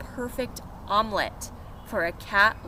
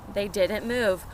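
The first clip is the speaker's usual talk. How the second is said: ordinary delivery, nothing marked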